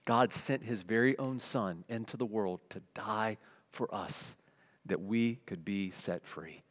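ordinary talk

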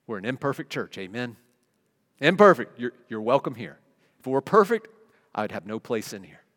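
ordinary delivery

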